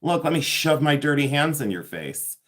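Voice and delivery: normal talking voice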